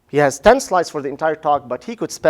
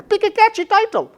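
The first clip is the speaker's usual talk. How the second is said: much higher, pitched-up burst of enthusiasm; excited urging. Shown as a demonstration. Professor urging students to pick catchy titles, excitedly. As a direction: sell it like it's the best idea ever.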